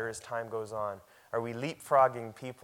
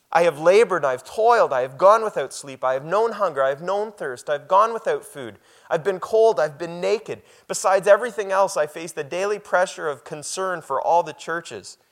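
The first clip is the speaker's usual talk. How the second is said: loud, high, ringing scripture reading; preaching at full force; 6 semitones higher, 9 dB louder than usual. Acted. Pastor to congregation, reading Paul's boast, loud and ringing. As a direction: proclaim each question like a challenge. Reads Paul's list of hardships.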